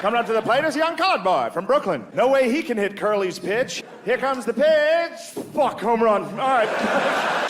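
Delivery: put-on high, old-timey radio announcer voice. Mock announcer opening the ballgame, old-timey radio voice. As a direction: call the game like the forties. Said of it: "You can hear the announcers like".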